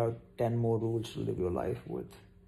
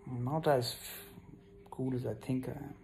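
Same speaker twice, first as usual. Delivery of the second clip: usual speaking voice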